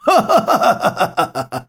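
high, despairing laugh that falls away; bitter laugh. A narrator's reading. Defeated lord laughs bitterly at his betrayer. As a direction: laugh at your own ruin. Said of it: "萧齐大笑道".

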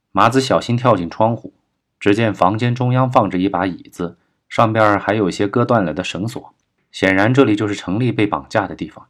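plain reading voice

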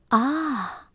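soft, anxious little gasp; nervous. A narrator's reading. Mama hearing about tigers, softly nervous. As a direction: let out a softened worried ah. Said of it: "妈妈紧张地说".

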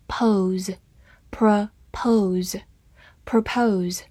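slow, stretched syllable-by-syllable; drawn-out pronunciation model; slow syllable drill. Shown as a demonstration. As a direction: break the word apart slowly for learners.

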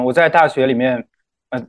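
normal talking voice

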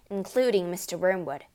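ordinary delivery

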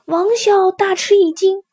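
plain reading voice

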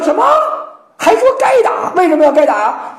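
can't believe what he hears; outraged disbelief. Acted. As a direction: Confucius hearing his student defend the attack, outraged. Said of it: "孔子说".

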